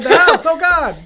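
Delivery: loud, high startled cry; startled shout. Real, not acted. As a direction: jolt at a sudden bang. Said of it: "why did your dog just make that noise".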